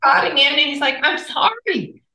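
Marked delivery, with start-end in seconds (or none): 1.0-2.0 high, drawn-out imitated apology; mock apology. Wife imitating husband's apology to her, high mock protest. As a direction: stretch it out as a high, plaintive quote.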